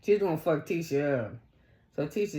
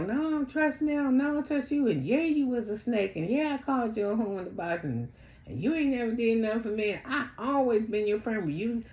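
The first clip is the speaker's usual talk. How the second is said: high-pitched retold quarrel; acted-out accusation; 6 semitones higher than usual. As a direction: mimic someone listing grievances, voice raised in pitch. Acting out a heated argument.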